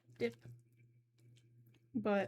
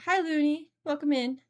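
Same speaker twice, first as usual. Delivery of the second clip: louder, bright call-out hello; excited greeting; 4 semitones higher, 9 dB louder than usual. Real, not acted. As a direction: call out a welcome to a new viewer. Greeting a new chat viewer.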